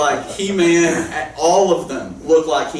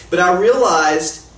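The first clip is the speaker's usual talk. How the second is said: ordinary delivery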